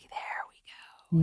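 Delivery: hushed, drawn-out whisper; whispered aside. Retold or imitated. Retelling her reaction to husband's criticism, whispered. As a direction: whisper it slowly under your breath.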